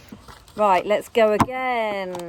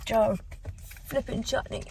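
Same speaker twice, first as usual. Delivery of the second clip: straining at a stuck lid; strained words while twisting a lid; opening a stuck jar; 4 semitones higher, 6 dB quieter than usual. Real, not acted. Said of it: "So I'm trying to open this".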